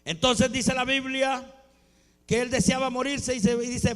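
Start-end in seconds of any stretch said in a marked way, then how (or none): none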